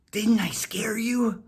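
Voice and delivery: slow, spooky whisper of a cat. Acted. Whiskers the cat whispering to scare Molly, slow. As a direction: hiss it to scare the dog.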